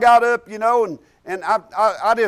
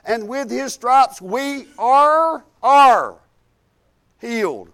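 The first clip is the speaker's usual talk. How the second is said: fervent recitation of Isaiah, climbing higher; preaching scripture; 3 semitones higher than usual. Acted. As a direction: preach the verse with rising fervour, slowing at the end.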